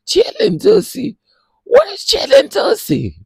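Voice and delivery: very high, loud incredulous squeal; very high, loud and disbelieving; squealing disbelief. Real, not acted. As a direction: shriek it like you can't believe it.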